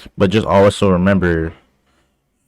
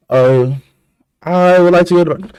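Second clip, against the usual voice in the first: wrong recruit request; 10 semitones higher, 5 dB louder than usual. Retold or imitated. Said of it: "I'm like".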